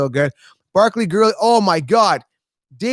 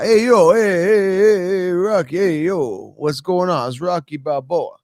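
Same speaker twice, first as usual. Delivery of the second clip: Rocky; slower than usual. Acted. Streamer showing off for viewers, Rocky impression. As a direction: call out like a punchy movie boxer. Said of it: "That's my Rocky impression".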